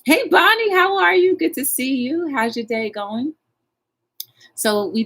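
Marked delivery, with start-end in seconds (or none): 0.1-3.3 much higher and louder sing-song hello; high greeting. Real, not acted. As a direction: sing out a welcome to a viewer. Greets viewer joining live chat.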